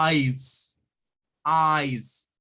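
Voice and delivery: ICE said as "ais", silent E; slow, isolated model of ICE; English pronunciation demo. Shown as a demonstration. Teacher showing students how to say hielo, clear pronunciation demo. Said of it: "Vamos a decir".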